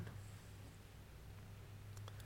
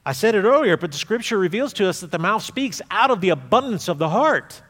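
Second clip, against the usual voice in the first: ordinary delivery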